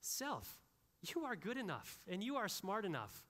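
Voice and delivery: preacher mocking a man praising himself in the mirror, high and sweet; mirror pep talk. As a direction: coo at yourself in the mirror. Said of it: "looking in the mirror and saying".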